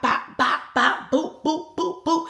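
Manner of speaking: rhythmic bops climbing in pitch; imitated punch sounds. Storyteller acting out them swinging on each other, imitated punch sounds. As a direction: voice each punch as a quick popping boop.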